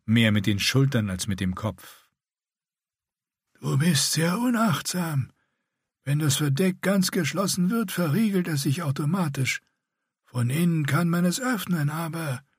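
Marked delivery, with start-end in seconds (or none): 3.6-12.4 painful rasp. A narrator's reading. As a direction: force every word out painfully. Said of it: "jedes Wort zur schmerzhaften Anstrengung".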